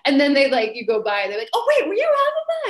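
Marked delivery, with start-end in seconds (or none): 1.5-2.7 very high, loud amazed gasp of recognition; sudden realization. Retold or imitated. Fan recognizing Elphaba as she goes by, amazed squeal.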